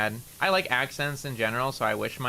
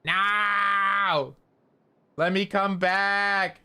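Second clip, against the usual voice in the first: real frustrated shout; 8 semitones higher, 3 dB louder, much slower than usual. Real, not acted. Game lag kicks him out.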